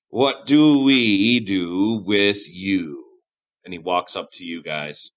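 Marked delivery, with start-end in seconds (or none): 0.1-3.0 slow, drawn-out villain musing; ominous, deliberate question in character; menacing leader. Acted. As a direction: stretch every word as you size up captives. Leader turns menacingly to the captives.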